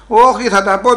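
loud mock-stubborn outburst; acted defiance. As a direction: refuse loudly to be hushed. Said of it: "Μη μίλεις έτσι".

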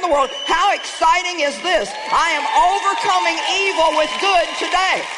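rally the congregation; preaching at full force. Acted. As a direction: proclaim it like a victory shout. Preaching climax.

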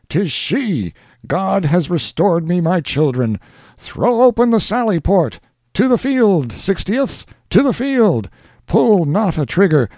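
high, loud frantic commander; father ordering troops to save his daughters; overjoyed father commanding. Acted. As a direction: rejoice and command at once. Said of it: "parental agony".

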